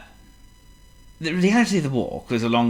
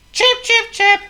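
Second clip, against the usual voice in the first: high chirping bird calls; birdsong; 8 dB louder, slower than usual. Acted. Birds waking Mister Calm, high chirping.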